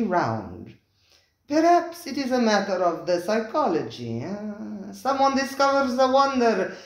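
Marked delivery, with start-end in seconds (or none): 1.5-6.7 effusive host. Acted. Spiro the restaurateur welcoming his diners, high and effusive. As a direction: gush over the guests like a fawning host.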